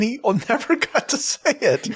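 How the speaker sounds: can't stop laughing. Real, not acted. Laughing at Jeff's plight.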